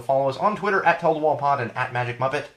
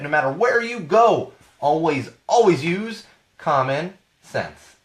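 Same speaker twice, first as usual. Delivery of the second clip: raised, loud host outro with a big finish; podcast sign-off; 6 semitones higher, 3 dB louder than usual. Acted. Host signing off to his Wall fans, announcer style. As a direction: announce the show's close and motto.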